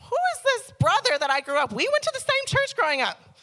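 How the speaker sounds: high, loud disbelieving outrage; indignant. Retold or imitated. Sister baffled by her born-again brother, indignant. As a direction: throw the questions back at him.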